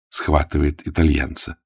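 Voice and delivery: ordinary talk, nothing marked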